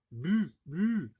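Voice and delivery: "bu" repeated high with a held French u; distinct u sound. Shown as a demonstration. Teacher warning it isn't bi, held high "bu". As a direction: isolate "bu" clearly so it cannot be taken for "bi". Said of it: "je n'ai pas dit bi".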